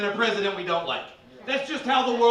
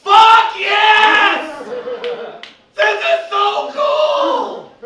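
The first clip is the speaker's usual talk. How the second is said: much higher and louder, half-sung whoop; inner fan delight; 13 semitones higher, 12 dB louder, much slower than usual. Retold or imitated. His inner Hot Topic kid cheering, ecstatic shout. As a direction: scream it like a thrilled teen metalhead. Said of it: "rap metal part of me was like".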